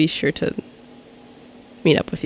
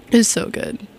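ordinary talk

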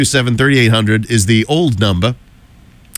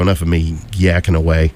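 ordinary talk

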